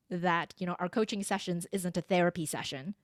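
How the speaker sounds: ordinary delivery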